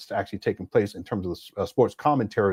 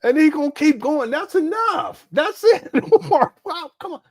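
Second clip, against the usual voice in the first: much higher, loud exasperated cry of enough; real exasperation, pitched way up; exasperated protest; 5 dB louder than usual. Real, not acted. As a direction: throw your hands up at the screen. Exasperated at Umar's clip.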